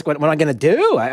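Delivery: normal talking voice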